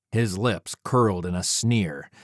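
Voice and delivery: ordinary delivery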